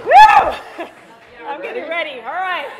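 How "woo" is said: high, very loud whoop; excited whoop. Real, not acted. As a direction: let out a piercing whoop to psych yourself up. Bracing for the ice bucket.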